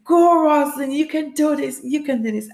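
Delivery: high, loud opening cheer; cheering crowd. Retold or imitated. Spectators near the finish urging a runner, excited cheer. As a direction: urge her on from the sidelines. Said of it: "people saying".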